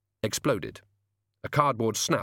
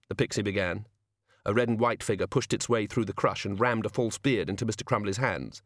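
plain reading voice

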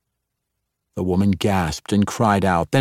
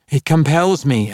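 higher, louder anguished cry; pleading cry, pitched up and loud; cried out; 7 semitones higher, 4 dB louder than usual. A narrator's reading. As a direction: cry it out in desperation. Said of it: "Alvida cried".

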